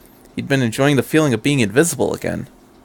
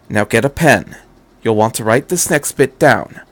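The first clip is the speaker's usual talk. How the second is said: ordinary delivery, nothing marked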